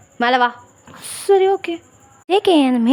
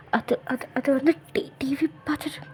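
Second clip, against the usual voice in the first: much softer halting stammer sinking to a whisper; frightened stammer; 3 semitones lower, 10 dB quieter than usual. Acted. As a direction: caught out, barely get it out. Caught JK stammers in fear. (பயத்தில் திக்கித் திணறும் கிசுகிசுப்பு)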